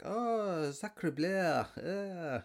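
high, hammed-up French "sacre bleu"; mock French exclamation. French tourists react, a hammed-up high French "sacre bleu". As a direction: ham up a cartoon French gasp. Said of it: "I've been dying to use that stupid line".

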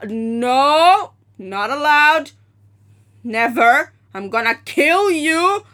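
loud, stern mom voice; angry mom. Retold or imitated. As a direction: scold your kid hard, slowly. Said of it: "This is what my mom says".

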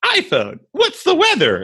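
high-pitched, hammed-up commercial voice; mock a sweetly clueless phone question; Zooey Deschanel. Comic mocking an iPhone commercial actress, put-on high voice. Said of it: "And she's like".

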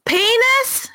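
put-on high, questioning child; high kid voice. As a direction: squeak it like a toddler. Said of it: "He was like".

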